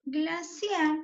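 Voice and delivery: Glaciar pitched high, final syllable CIAR stressed; demonstrating the strong "ciar" in Glaciar. Shown as a demonstration. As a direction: sound out "Glaciar" with weight on its last syllable. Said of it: "su sílaba tónica es ciar".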